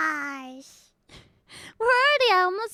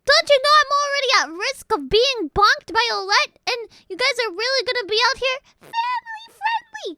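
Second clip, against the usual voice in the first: louder, higher, fast indignant push-back; indignant rant; 7 semitones higher, 6 dB louder than usual. Real, not acted. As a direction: tell off the chat who is lecturing. Scolding chat for lecturing her.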